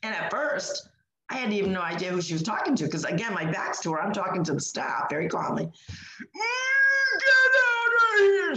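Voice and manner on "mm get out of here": very high, drawn-out strained shriek; demon-like voice. Retold or imitated. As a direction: stretch the words into a loud demonic screech. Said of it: "in this demon-like voice".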